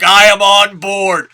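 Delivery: loud, slow, thrilled declaration; drawn-out shout of approval; excited. Retold or imitated. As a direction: announce it like a fan won over. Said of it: "And I was like".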